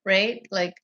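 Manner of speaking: normal talking voice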